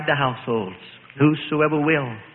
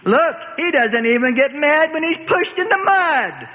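high, mocking call-out; higher jeering taunt; jeering singsong; 8 semitones higher than usual. A narrator's reading. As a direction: sing it out to mock him. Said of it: "sings out a girl, jeering".

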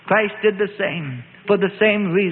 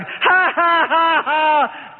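higher jeering ha-ha; mocking laugh; 10 semitones higher, much slower than usual. A narrator's reading. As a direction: laugh at him cruelly. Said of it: "They laughed".

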